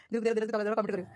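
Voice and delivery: ordinary talk, nothing marked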